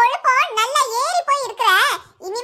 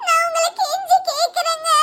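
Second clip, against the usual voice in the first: higher, more desperate repeat of the plea; 5 semitones higher than usual. Acted. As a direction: push the begging up, near tears. (மேலும் உருக்கமாக கெஞ்சல்)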